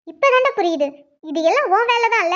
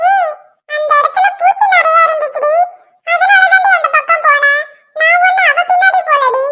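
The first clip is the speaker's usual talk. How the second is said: lightly raised coaxing husband voice; a hint of a wheedling husband; pleading husband; 8 semitones higher than usual. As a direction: sweet-talk your wife out of her anger. Husband defending himself in quarrel.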